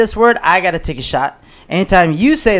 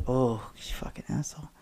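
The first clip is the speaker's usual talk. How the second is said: frustrated whisper; 7 semitones lower, 19 dB quieter than usual. Real, not acted. Losing the word game.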